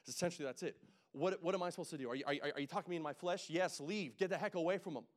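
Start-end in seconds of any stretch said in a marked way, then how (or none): 1.1-5.1 mock exasperated counselor. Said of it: "Very nicely, didn't say it like that".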